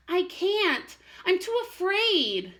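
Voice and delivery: high, small frightened voice; scared little bird. Acted. Little robin refusing to fly, mock frightened. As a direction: squeak it out afraid.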